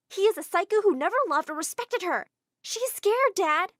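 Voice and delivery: high, loud urgent pleading; desperate plea. Acted. Girl desperately pleading with her dad. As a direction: beg dad to listen.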